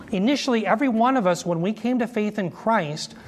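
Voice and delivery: ordinary delivery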